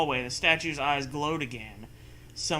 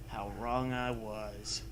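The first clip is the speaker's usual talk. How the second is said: low, slow, hushed doom; ominous hush; 5 semitones lower, 10 dB quieter, much slower than usual. Acted. Narrator hushing the friend's story into doom. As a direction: drop into a dark foreboding voice.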